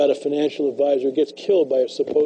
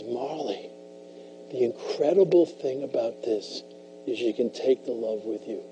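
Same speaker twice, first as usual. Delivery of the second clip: softer, a gentle whisper-like goodbye; tender hushed farewell; 2 semitones lower, 9 dB quieter than usual. Retold or imitated. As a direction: say it like a last loving goodbye, much softer. Sam's tender farewell to Molly.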